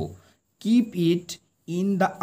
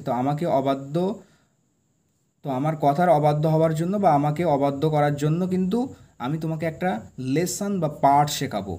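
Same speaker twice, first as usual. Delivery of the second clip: usual speaking voice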